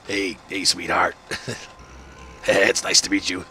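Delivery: put-on raspy old lady voice; hint of a chain-smoking older woman; smoker's rasp. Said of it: "that lady I went on the date with".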